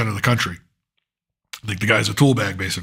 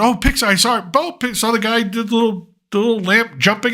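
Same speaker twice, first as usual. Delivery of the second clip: higher, eager Pixar fan; hopeful fan voice, pitched up; excited; 7 semitones higher than usual. Retold or imitated. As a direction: gush about the little lamp like a fan. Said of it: "I sat and I said".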